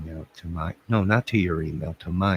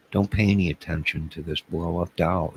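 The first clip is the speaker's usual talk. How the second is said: normal talking voice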